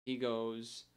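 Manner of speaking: normal talking voice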